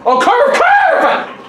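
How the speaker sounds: very high-pitched yell at the ball; frantic urging. Real, not acted. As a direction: shriek it to will the ball round.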